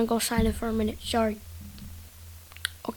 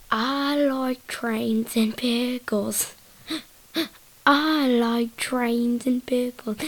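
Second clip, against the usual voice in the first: slow, drawn-out silly recital; trains-meme imitation; much slower than usual. Kid podcaster to the guy with the pen, slow silly voice. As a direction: stretch every word out slowly.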